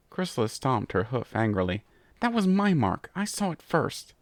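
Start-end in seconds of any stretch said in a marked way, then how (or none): 2.2-4.1 indignant. Acted. Angry squabble, stomping hoof.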